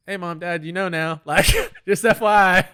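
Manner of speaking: high, loud playful call-out; mock announcement to parents. Jokingly addresses parents who may watch.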